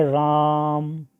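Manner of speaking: राम with the आ stretched long, as if calling someone; drawn-out calling vowel. Shown as a demonstration. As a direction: call out राम, holding the vowel. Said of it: "किसी को पुकारने में या नाटक के संवादों में".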